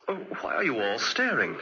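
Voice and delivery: usual speaking voice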